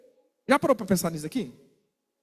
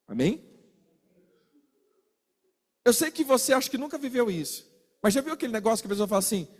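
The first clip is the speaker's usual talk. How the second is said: usual speaking voice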